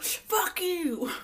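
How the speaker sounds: high, drawn-out parting insult; mock defiant shout. Angela refusing to come back, mock defiant.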